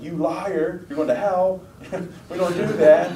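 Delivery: half-laughing mock rant at a lying kid; mock flip out. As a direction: pretend to blow up, laughing through it. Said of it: "Do I, like, flip out".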